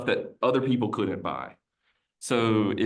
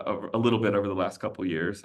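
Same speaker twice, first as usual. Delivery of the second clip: ordinary talk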